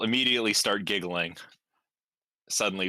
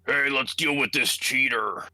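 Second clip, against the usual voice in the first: slow, slightly raised, hammed-up man's voice; put-on man voice; 5 semitones higher, 4 dB louder, much slower than usual. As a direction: play a man calling out, drawn out. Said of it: "her best man voice impression".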